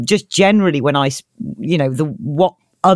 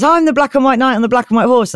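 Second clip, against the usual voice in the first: higher-pitched mock-heroic announcement; hammed-up storybook hero; proclaiming knight; 6 semitones higher, 3 dB louder than usual. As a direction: declare yourself grandly to the dragon. Knight announces himself to dragon.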